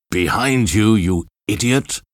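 shout. A narrator's reading. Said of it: "he shouted to Brunt".

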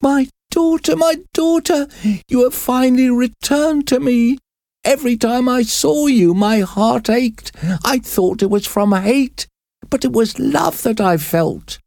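very high, overjoyed mother's voice; crying with joy. A narrator's reading. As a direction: weep out your joy at finding her. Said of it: "cried with joy".

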